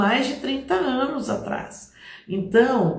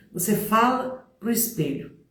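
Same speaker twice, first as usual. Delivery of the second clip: usual speaking voice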